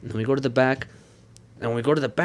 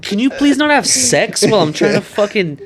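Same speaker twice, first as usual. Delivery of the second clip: exasperated outburst; 8 semitones higher, 6 dB louder than usual. Retold or imitated. Exasperated at couple mid-piercing.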